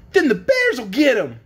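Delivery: put-on high, loud little child; child's voice. A narrator's reading. Child warning about the horse, high voice. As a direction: pipe up like an alarmed youngster. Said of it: "said one of the children's voices".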